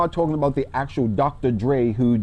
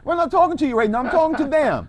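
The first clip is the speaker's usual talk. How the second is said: playful mock scolding; 12 semitones higher than usual. Real, not acted. Host playfully shushing guest.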